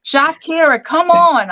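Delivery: high, calling out to her. Retold or imitated. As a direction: call her out of the crowd. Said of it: "'cause he never called me [name]".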